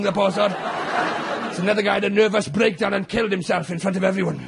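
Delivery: ordinary talk, nothing marked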